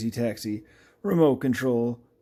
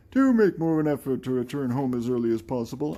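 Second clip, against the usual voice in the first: put-on voice, formal advice from a game character; 3 semitones higher, 4 dB louder than usual. Game character urges an earlier return home, put-on character voice. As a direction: stiff game voice-acting. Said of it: "I'm trying to do these in the voices".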